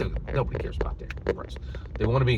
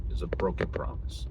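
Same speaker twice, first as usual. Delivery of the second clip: "It was a broken promise" whispered, softer; whispered repeat; 4 semitones lower, 7 dB quieter than usual. Real, not acted.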